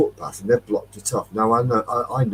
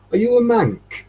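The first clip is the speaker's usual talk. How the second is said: lightly put-on Belfast accent, higher; a hint of a Belfast lilt, pitched up; 13 semitones higher, 5 dB louder than usual. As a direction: quote the Belfast farmer's question in his accent. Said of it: "Uh, strong accent".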